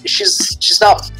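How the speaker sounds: ordinary delivery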